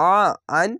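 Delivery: normal talking voice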